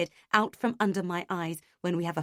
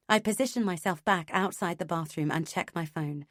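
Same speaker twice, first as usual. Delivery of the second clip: usual speaking voice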